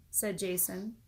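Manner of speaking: ordinary delivery, nothing marked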